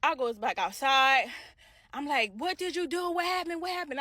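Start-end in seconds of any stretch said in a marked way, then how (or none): 2.3-4.0 high-pitched frantic yell at the boss, level steady; desperate demanding questions, voice jumped up; retold yelling. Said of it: "I'm yelling at him".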